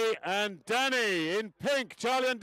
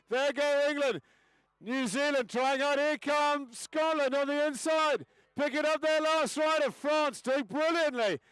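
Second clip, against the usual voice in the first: high, lively play-by-play call; live race commentary; 4 semitones higher than usual. Acted. Announcer calling the race for the crowd, lively play-by-play. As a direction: call the race as it happens, riding the action.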